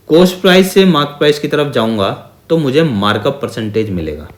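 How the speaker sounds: ordinary talk, nothing marked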